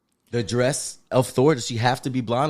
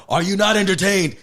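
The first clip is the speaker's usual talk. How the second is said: higher-pitched, declaimed film quote; Maximus from Gladiator; 8 semitones higher than usual. Retold or imitated. Fan quoting Gladiator to argue for Zeus's voice, declaimed impression. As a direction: throw out the line as an arena challenge. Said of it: "Maximus from Gla".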